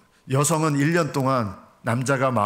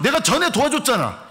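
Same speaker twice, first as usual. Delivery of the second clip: much higher mock quarrel; arguing husband; 11 semitones higher, 3 dB louder than usual. Husband quarrelling with wife over chores, hammed-up anger. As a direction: play the husband shouting back in a fight. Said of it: "이러면서 그것 때문에 막 싸우고".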